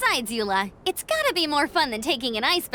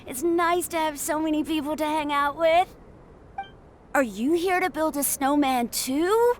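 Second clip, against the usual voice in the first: put-on young boy voice; 2 semitones higher than usual. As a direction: speak like a cheerful little boy.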